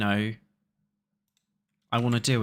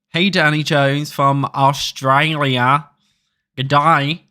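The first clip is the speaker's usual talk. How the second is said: louder, slow, exaggerated Australian accent greeting; bad Aussie accent; 8 dB louder, slower than usual. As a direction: butcher an Australian accent on purpose, hammed up. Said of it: "sorry for butchering the Aussie accent".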